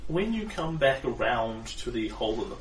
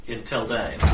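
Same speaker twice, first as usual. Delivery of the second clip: normal talking voice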